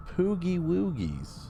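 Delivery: high, slow cooing voice; cooing baby talk. Acted. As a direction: coo it like to a pet. Cooing at in-game pet.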